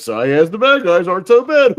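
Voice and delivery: booming movie trailer narrator voice; trailer voiceover guy. Retold or imitated. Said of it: "all those movies have the same, uh, trailer voiceover guy".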